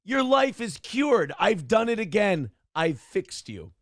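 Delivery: triumphant cheer. Real, not acted. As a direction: crow over the win, then ease off. Celebrating solving a viewer's problem.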